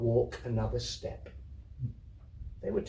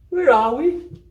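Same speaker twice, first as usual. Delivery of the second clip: put-on very high, loud little girl; waking child; 13 semitones higher, 10 dB louder than usual. Lottie waking on the dray asking where they are, very high. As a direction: pop up from sleep and ask. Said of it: "said Lottie, sitting up".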